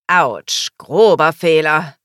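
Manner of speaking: loud, drawn-out heckle; calling out. A narrator's reading. Friend loudly heckles a man giving a voucher, calling out. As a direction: call it out across the room. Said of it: "rief Justus".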